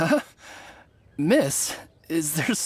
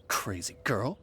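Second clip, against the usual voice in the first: sneering scoff; 10 semitones lower, 11 dB quieter, slower than usual. Acted. Bandit sneers at the girl.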